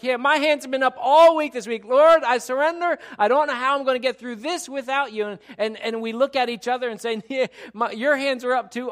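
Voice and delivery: high, loud, urgent sermon delivery; impassioned preaching. Real, not acted. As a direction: preach it at full force, rousing the church. Preaches at full force.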